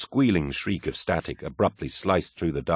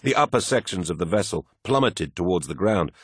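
plain reading voice

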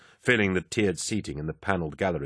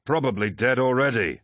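higher, forceful interruption; interrupting; 5 semitones higher than usual. A narrator's reading. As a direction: break in over the other speaker. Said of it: "Aximan interrupted".

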